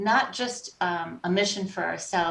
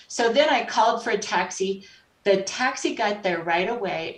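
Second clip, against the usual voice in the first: normal talking voice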